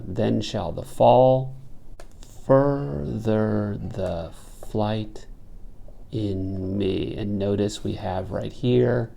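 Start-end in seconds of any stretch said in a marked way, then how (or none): 0.0-7.1 "then shall the fall", pause, then "FUR-ther the flight" very slowly; slow metrical scansion with a break after "fall"; trochaic inversion after caesura. Shown as a demonstration. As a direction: stretch "further the flight in me" syllable by syllable, stress first on "fur". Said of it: "trochaic inversion after the caesura".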